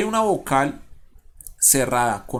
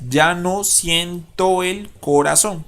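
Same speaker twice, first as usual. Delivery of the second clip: "ya no siento el corazón" slow, measured and firm, each syllable marked; 3 semitones higher, 4 dB louder, slower than usual. Shown as a demonstration. Teacher reading for the syllable count, "ya no siento el corazón" slowly segmented. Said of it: "Contemos cuántas".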